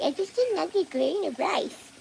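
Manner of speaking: high, slow whiny voice on "another grain of rice"; high mock whine. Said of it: "It's like".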